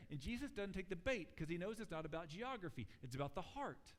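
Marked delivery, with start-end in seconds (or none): none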